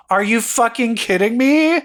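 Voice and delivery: high, loud mock disbelief; incredulous retold outburst; retold outrage. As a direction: imitate someone outraged at a betrayal. Retold outraged reaction to betrayal.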